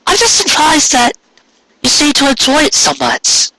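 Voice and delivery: high, gentle mare's voice; kindly, a little amazed; Cupcake voice. A narrator's reading. Cupcake telling Pinkie she seems to enjoy it, high and gentle.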